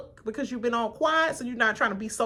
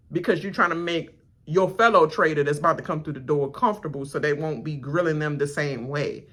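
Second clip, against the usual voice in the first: ordinary delivery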